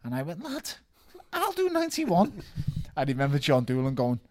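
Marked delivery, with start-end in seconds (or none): 0.4-2.4 cocky boast. Retold or imitated.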